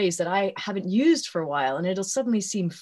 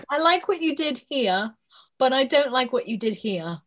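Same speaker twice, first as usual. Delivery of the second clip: imitating six-year-old; 4 semitones higher than usual. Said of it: "he'll go".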